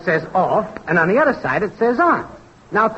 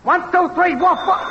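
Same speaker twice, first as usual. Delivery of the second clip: high-pitched, singsong mic-test chant; woofing mic-test yell; 8 semitones higher than usual. Acted. Technician testing if the show is on air, high woofing yell. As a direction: chant it high, evenly, like barked test words. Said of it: "woof woofing and yelling".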